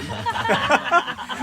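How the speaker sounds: loud, high burst of laughter; real laugh. Real, not acted. Amused while greeting unseen online listeners.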